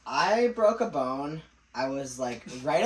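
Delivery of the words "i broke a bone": drawn-out "I broke a bone", rising at the end; odd proud announcement. Real, not acted. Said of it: "it's the way he said it".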